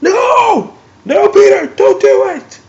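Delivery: very high, strained cry of no; anguished cry. Retold or imitated. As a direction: scream it like watching someone fall.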